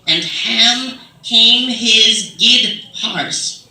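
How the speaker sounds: drawn out, loud, labored reading; uninflected reading. Acted. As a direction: stretch each word out, puzzling through old English. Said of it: "make sense if you say it in your accent".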